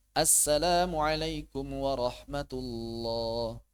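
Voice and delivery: drawn-out careful salam; slow salam. Shown as a demonstration. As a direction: say it slowly and fully. (salam pelan dan lengkap)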